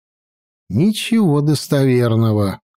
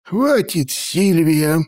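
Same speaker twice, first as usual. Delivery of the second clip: cold; 5 semitones higher, much slower than usual. A narrator's reading. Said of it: "холодно сказал старик".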